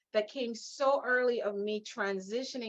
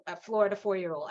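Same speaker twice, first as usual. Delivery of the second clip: ordinary talk, nothing marked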